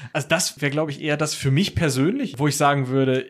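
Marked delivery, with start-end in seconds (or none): none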